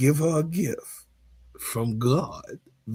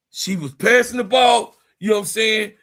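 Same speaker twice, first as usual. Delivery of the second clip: higher, louder tall-tale telling; wild comic rant; 6 semitones higher, 5 dB louder than usual. Acted. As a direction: pile on the absurd story, worked up. Comic exaggerated tale, loud.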